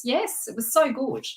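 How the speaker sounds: usual speaking voice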